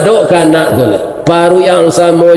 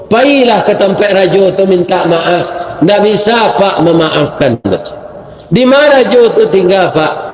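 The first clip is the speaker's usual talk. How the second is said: ordinary talk, nothing marked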